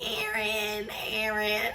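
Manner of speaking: half-hearted moaned name; mock moaning. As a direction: moan the name lightly, imitating. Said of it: "you was moaning".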